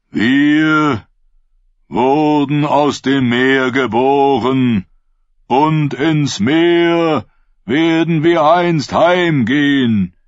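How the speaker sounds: high, loud, slow sermon to the crowd; preaching prophet. Acted. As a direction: proclaim it like a fervent prophet. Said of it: "begann er wie schon hundertmal". (Prophet predigt laut und langsam zu seiner Schule)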